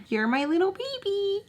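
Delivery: mock coo it to shut him down; baby talk. Belittling the ex in front of friends with baby talk. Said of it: "And I said".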